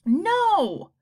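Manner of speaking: put-on a kid close to crying; near tears. A narrator's reading. Boy protesting, near tears. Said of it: "really about to cry".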